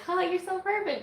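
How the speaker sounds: high, put-on adoring voice; mock swoon; playful fangirl gushing. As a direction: coo it high like a starstruck fan. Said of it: "it's fun to be like".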